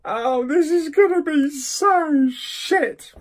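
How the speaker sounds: high, slow despairing whine; wailing dread. Real, not acted. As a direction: moan it high and drawn out. Dreading the film he drew.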